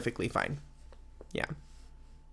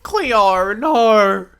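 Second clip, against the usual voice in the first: dramatic cry; 8 semitones higher, 12 dB louder than usual. Acted. Emotional Australian woman cries out.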